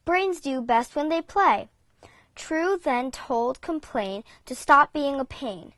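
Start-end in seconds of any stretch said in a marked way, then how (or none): none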